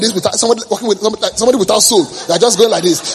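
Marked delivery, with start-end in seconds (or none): none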